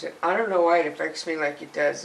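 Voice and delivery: normal talking voice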